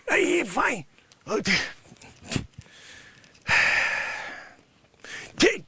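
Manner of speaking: ordinary talk, nothing marked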